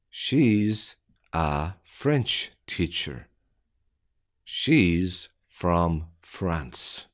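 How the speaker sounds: slow, deliberate, word by word; careful slowed-down reading; slow dictation. Shown as a demonstration. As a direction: spell it out slowly for beginners. Slow repeat for learners.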